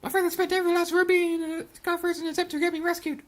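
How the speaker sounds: put-on high girlish voice, plaintive; high-voiced self-pitying girl; once-rich girl. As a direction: tell your sad past in a high girl voice. Voices girl's tragic backstory.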